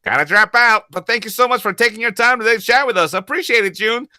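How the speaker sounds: high, loud, fast cartoon voice; performed chat message. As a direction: perform the goodbye like a bubbly cartoon. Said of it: "read and perform the conversations".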